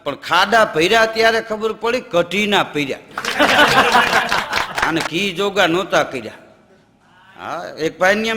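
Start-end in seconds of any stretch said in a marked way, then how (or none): 3.2-4.8 a short real laugh at the joke; light chuckle; amused laugh. Real, not acted. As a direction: laugh along with the punchline.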